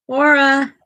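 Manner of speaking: loud, high, drawn-out call. Real, not acted. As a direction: sing her name out to the dog. Calling the dog again.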